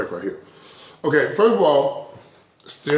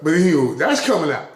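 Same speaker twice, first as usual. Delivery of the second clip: ordinary delivery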